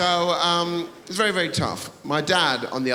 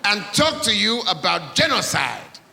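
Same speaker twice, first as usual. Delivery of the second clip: mock preachy father; dad's threat; 7 semitones higher than usual. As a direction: threaten the naughty boy like a dramatic dad. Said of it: "He'd go".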